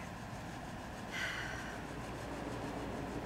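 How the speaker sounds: long breathy exhausted sigh; tired sigh. Real, not acted. Overheated, ending the workout.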